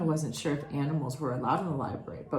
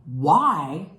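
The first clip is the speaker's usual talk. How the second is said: loud, emphatic burst; louder indignant why; exclaimed why; 3 semitones lower, 9 dB louder than usual. Acted. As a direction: blurt it out in disbelief. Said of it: "he sprouted".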